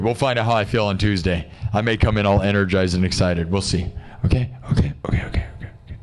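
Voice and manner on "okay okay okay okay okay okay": whispered okays. Real, not acted. Hushing himself before the stream.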